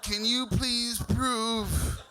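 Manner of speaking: drawn-out, slow mock bank voice; slow bank caller. Mocking the bank caller asking for a password, slow and drawn out. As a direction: spell it out slowly like a patronizing bank rep. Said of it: "Then they go".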